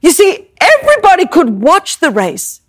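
usual speaking voice

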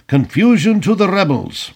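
high-pitched rousing toast; proclaimed toast. A narrator's reading. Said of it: "proclaimed his sentiment".

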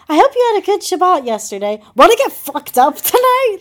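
high, loud mock-incredulous quote; hammed-up disbelief at a friend's text; mocking repeat.

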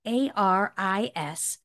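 slow, letter by letter; spelling out slowly. Real, not acted. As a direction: spell it out carefully. Host spells out the name.

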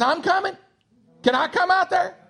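high, loud eager shout across water; acted calling out. Peter calling to Jesus from the boat, acted calling out.

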